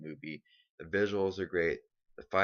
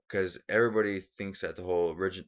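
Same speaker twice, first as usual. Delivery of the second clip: usual speaking voice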